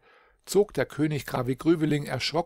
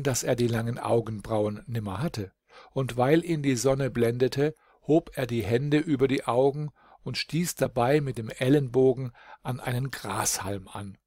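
plain reading voice

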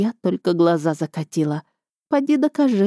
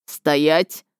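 barked command. A narrator's reading. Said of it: "и рявкнул".

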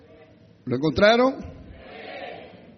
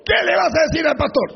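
higher, louder, fast mock retort; husband retorting; 8 semitones higher, 4 dB louder, faster than usual. Pastor acting an angry husband answering his wife, loud. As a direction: snap back at your wife. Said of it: "la esposa le dice".

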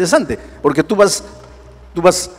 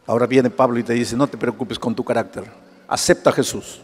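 much lower and softer, intimate sermon; hushed preaching; 7 semitones lower, 4 dB quieter than usual. Acted. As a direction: confide the good news quietly. Hushed preaching over music.

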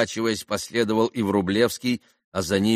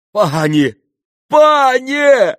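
loud wail, jumping higher; crying out; 13 dB louder, much slower than usual. A narrator's reading. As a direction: wail her title, higher the second time. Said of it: "возопил Митя". (отчаянный вопль)

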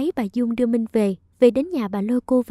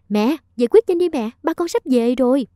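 ordinary delivery, nothing marked

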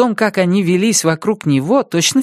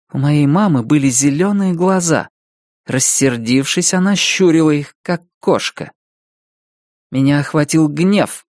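ordinary delivery, nothing marked

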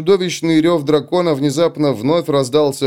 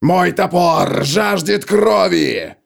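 slow, raised warrior voice; slow battle cry; 6 semitones higher, much slower than usual. Acted. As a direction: drag out the war cry. Gotrek challenges the dragon.

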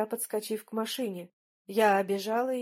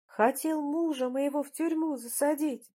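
high-pitched indignant wife; unrelenting accusation; 6 semitones higher than usual. A narrator's reading. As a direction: keep railing at him, pitched high. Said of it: "не унималась Крапивина". (не унимаясь, высоким возмущённым голосом)

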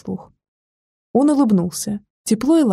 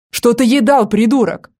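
louder, cold and menacing question; icy tone; 3 semitones higher, 5 dB louder than usual. Acted. Brother interrogating the boy on the lawn, icy louder menace. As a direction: freeze him with every word. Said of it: "ледяным тоном произнёс брюнет".

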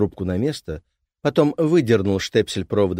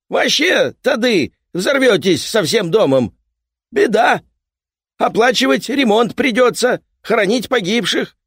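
raised voice; 14 semitones higher, 8 dB louder than usual. Acted. Repairman keeps shouting.

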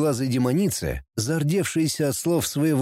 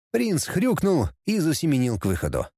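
ordinary delivery, nothing marked

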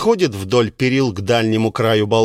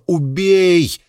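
passionate inner cry; 5 semitones higher than usual. Acted. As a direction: let the feeling shout it. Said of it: "Рассудку вторит чувство".

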